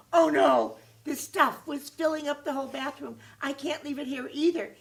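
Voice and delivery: high, loud, panicked "oh no"; near-shouting in rising alarm. Acted. Jimmy sees the bathroom filling.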